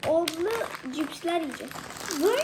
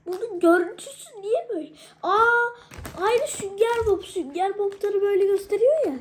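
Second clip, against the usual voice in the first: excited surprise; 5 semitones higher, 6 dB louder than usual. Real, not acted. Shocked at snack look.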